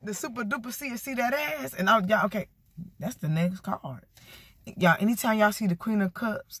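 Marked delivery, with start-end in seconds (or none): 0.0-1.7 soft, higher teasing chant; playful sing-song boast; sly catchphrase. Shown as a demonstration. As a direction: slip the catchphrase in quietly. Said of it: "Y'all hear me say".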